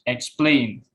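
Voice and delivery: "explain" with PLAIN stronger and falling; second-syllable stress. Shown as a demonstration. Student presenter repeating verb example, "explain" stressed on PLAIN. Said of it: "Most verb have stress on".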